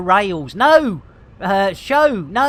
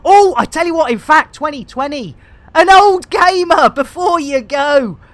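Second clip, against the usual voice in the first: excited; 7 semitones higher, 8 dB louder than usual. Real, not acted. Excited shout-out to old gamer.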